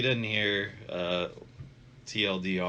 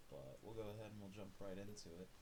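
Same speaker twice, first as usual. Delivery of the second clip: congested, nasal voice; talking through a stuffy nose; blocked nose; 3 semitones higher, 18 dB quieter, faster than usual. Real, not acted. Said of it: "Sorry if I sound a little nasally".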